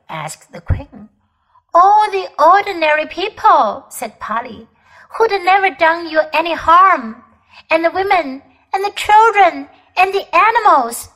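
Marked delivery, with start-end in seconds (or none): 1.7-11.1 high, louder, rising indignation; protest, getting more upset on each item; upset girl protesting. Acted. Polly protesting to the queen for the innocent, outraged.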